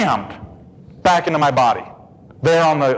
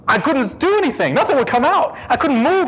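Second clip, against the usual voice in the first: strained, panicked; 8 semitones higher than usual. Retold or imitated. Paralyzed, unable to scream.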